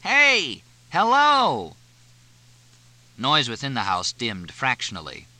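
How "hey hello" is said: full, high shout. A narrator's reading. Fletch yelling through the screen door, full shout. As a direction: yell as loud as you can. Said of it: "shouted as loudly as he could".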